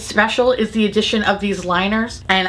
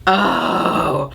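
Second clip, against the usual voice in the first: sharp gasp, then a breathy oh; surprised gasp; 2 semitones lower than usual. Real, not acted. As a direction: caught off guard by the colour. Gasp at unswatched shade.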